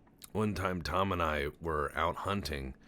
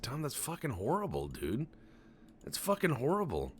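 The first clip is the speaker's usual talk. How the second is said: mock exasperation; 8 semitones higher than usual. Retold exasperation at Tom.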